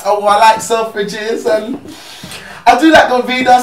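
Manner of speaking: put-on high, loud, drawn-out girly voice; mock a girl listing her luxury tastes; put-on high-pitched materialistic girl; imitated label-loving girl.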